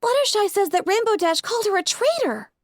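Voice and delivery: put-on high young filly voice; little sister reporting; Sweetie Belle. A narrator's reading. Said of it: "Sweetie Belle saying".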